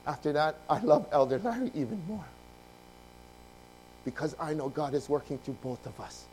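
normal talking voice

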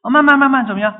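excited question. Real, not acted. Preacher quizzes congregation excitedly.

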